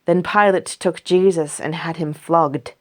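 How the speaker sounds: ordinary delivery, nothing marked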